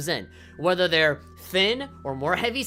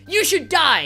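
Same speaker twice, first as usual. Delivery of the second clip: mock hateful shout; 10 semitones higher, 7 dB louder, slower than usual. Mocking hateful people.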